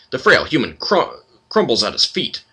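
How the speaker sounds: ordinary talk, nothing marked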